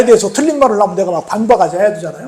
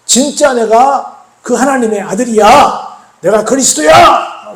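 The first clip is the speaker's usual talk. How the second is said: slow, shouted proclamation; loud proclamation; 4 semitones higher, 5 dB louder, slower than usual. Retold or imitated. Jesus proclaiming he is the Son of God, loud proclamation. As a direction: proclaim it loudly, stretching the words. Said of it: "라고 선포하시니까".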